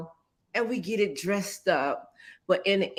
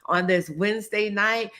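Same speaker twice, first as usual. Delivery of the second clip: usual speaking voice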